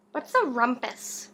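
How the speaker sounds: ordinary delivery, nothing marked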